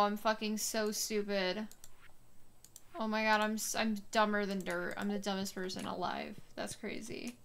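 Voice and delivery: usual speaking voice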